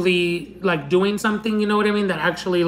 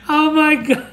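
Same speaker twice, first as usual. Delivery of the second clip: laughing; 6 semitones higher than usual. Real, not acted. Laughing at Grim Reaper video mention.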